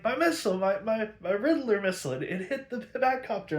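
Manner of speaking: high, stumbling over the words with glee; mock excited babbling; giddy stammering boast. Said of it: "They're like".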